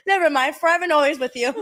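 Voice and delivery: words squeezed out through real laughter; laughing. Real, not acted. Friend cracking up over the forever-again joke, laughing hard.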